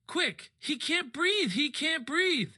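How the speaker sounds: high, urgent alarmed cry; panicked call for help. Real, not acted. Friend choking, running for nurse.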